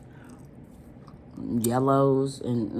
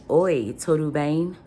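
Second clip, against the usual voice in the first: Portuguese phrase; 4 semitones higher, 6 dB louder, slower than usual. Shown as a demonstration. Vlogger showing her Portuguese to viewers, slow Portuguese greeting. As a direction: say Oi, tudo bem in a Portuguese accent, slow and a bit louder. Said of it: "I could say".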